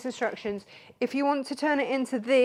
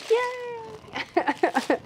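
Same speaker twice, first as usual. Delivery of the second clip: mock cheering; 12 semitones higher, 4 dB louder than usual. Mock cheer picking kits up.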